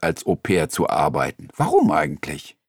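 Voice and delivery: ordinary talk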